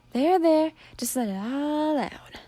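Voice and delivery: slow, comforting coo; soothing. Acted. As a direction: ease her down gently. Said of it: "rubbing Charlie's back in a comforting way".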